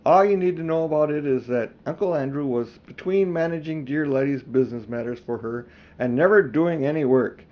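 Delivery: plain reading voice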